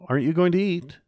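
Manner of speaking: put-on a little boy calling after his mother. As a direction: call out to mother walking away.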